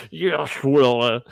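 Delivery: slurred, puffy-cheeked Hitchcock mumble; words squeezed out past puffy cheeks; jokey take on a jowly old director. Acted. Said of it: "his words came out like that".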